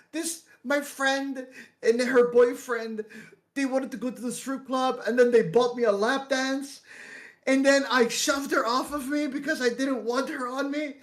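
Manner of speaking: high, whiny tearful account; mock crying. Drunk friend explains the unwanted lap dance, mock-crying. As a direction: imitate a friend wailing out his story. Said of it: "Why are you fucking crying?".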